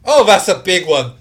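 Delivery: loud, high, excited yelling in character; acted battle cry. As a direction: holler like a fighter facing a huge foe. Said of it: "And also goes".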